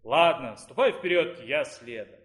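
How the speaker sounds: grudging consent, dropping low at the end; sighing resignation. A narrator's reading. Dean agreeing to follow the rector out, resigned. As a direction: give in reluctantly. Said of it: "тяжело вздохнул говоривший с ректором".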